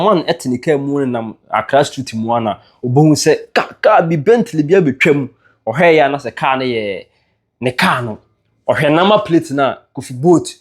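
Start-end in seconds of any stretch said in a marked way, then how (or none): none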